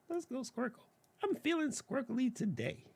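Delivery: high, very soft squeaky voice; tiny playful falsetto; silly high voice. Pretend. Playful aside choosing shape.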